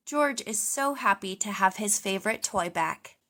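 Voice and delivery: usual speaking voice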